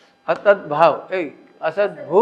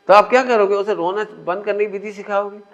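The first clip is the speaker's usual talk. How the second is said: normal talking voice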